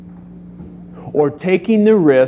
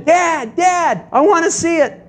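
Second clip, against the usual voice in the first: put-on much higher, eager kid voice; eager young son. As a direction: beg like an excited little boy. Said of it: "Jay comes running in".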